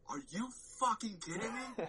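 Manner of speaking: high, disbelieving shout-like squeal; losing my mind. Retold or imitated. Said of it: "I was losing my mind".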